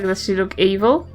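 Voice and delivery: ordinary talk, nothing marked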